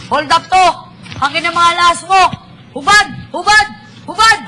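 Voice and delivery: loud barking robber commands; pretend robber. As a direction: bark orders like a robber. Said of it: "Kunwari, pulis ako".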